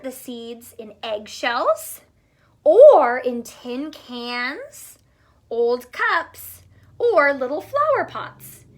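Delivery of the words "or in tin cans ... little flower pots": slow, much louder storybook phrase; slow, loud emphatic reading; loud, drawn-out. Shown as a demonstration. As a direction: stretch it out loud for the kids. Reading list to children.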